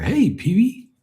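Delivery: high-pitched cartoon visitor's call; Cowboy Curtis greeting. Sidekick calling to the show's host, mock character voice. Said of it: "And it was Cowboy Curtis".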